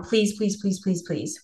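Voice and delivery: plead, fading out with each please; retold desperate begging. Begging nurses not to medicate.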